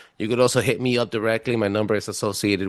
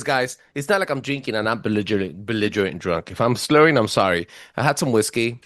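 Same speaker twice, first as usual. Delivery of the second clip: ordinary talk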